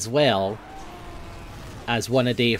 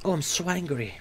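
softer, put-on deadpan voice; hushed comic echo of the angry shout; mock quiet anger; 4 semitones higher, 6 dB quieter than usual. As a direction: say it small and silly after the yell.

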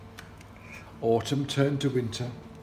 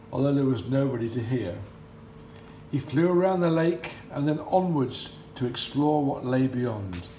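plain reading voice

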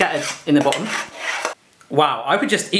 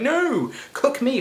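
pleading potatoes; 9 semitones higher, 4 dB quieter, much slower than usual. Retold or imitated. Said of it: "the other side was going".

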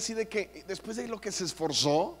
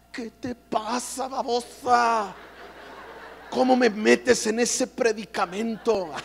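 slow, drawn-out shout at "babosa"; mock furious mother; hammed-up scolding; 3 semitones higher, slower than usual. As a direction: hiss at the daughter then burst out. Mother scolding daughter in parking lot.

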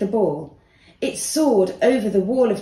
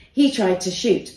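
plain reading voice

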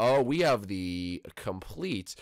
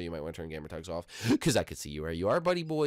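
normal talking voice

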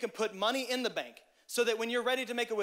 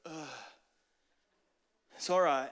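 drained mope; 5 semitones lower than usual. Retold or imitated. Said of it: "This is also what it sounds like".

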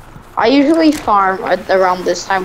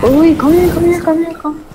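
excited; 8 semitones higher, 3 dB louder than usual. Real, not acted. Spotting something exciting mid-game.

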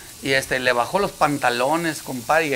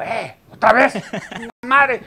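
higher, louder exasperated groan; fed-up magician; 9 semitones higher, 3 dB louder than usual. Retold or imitated. Magician fed up with the surfer, higher and louder. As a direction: sound fed up with the whole bus.

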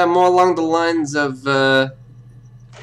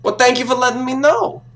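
sarcastic thanks; 8 semitones higher than usual. Pretend. Sarcastic detective mocking the suspect.

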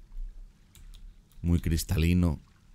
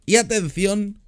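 excited announcement; 13 semitones higher, 3 dB louder, slower than usual. Real, not acted. Announcing entering the jungle.

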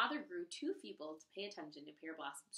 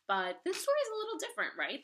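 excited; 9 semitones higher, 9 dB louder, faster than usual. Real, not acted. Teacher excited remark.

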